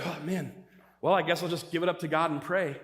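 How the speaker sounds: deflated mutter of surrender; retold resignation. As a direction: grudgingly settle for the fallback. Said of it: "I said these words".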